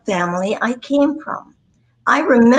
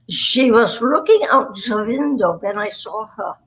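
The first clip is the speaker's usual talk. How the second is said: demonstrated German accent; put-on German accent. Shown as a demonstration. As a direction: recite a practice sentence in a German accent. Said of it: "that gets you into a German accent".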